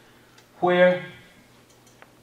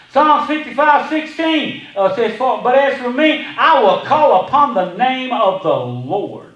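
full-force preaching; 7 semitones higher, 8 dB louder than usual. Acted. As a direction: lift the church with every line.

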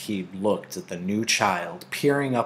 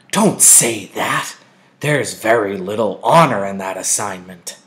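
sharp scolding; 7 dB louder than usual. A narrator's reading. Mother sharply scolds Lily for her wish. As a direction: lay down the law to the child. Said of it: "Mother spoke very sharply".

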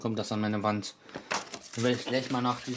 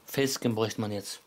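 ordinary talk, nothing marked

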